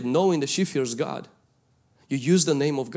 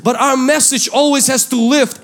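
higher, louder preaching, pressing every point; preaching at full force; 5 semitones higher, 5 dB louder than usual. Acted. Pastor urging the congregation, preaching at full force. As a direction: drive the message home to the crowd.

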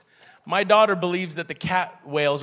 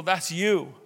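usual speaking voice

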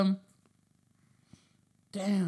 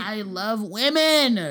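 slow emphatic; 7 dB louder, much slower than usual. Real, not acted.

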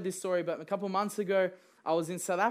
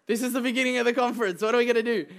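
fast, higher, louder rush of alarm; hammed-up worried outburst; mock panic; 4 semitones higher, 5 dB louder, much faster than usual. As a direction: act out the panic, racing. Panicking at conference start.

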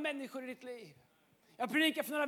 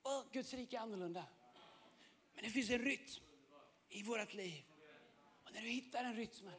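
much softer, low intimate preaching; hushed sermon; 7 semitones lower, 14 dB quieter than usual. Acted. Preacher softly confiding to the congregation, hushed. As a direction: draw the congregation in close and quiet.

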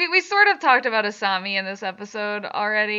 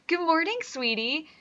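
mock sweet greeting; 5 semitones higher, 5 dB quieter than usual. Mocking Mako's line.

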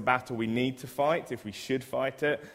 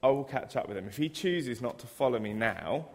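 normal talking voice

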